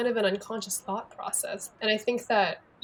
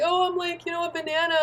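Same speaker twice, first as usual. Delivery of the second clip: high, put-on mocking tone; mock yourself in a silly higher voice; playful self-deprecating put-on voice; 9 semitones higher than usual. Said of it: "making fun of yourself".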